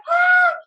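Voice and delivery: a sudden high, loud scream of frustration; frazzled scream. Retold or imitated. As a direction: let out a piercing cry of exasperation. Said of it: "It's like".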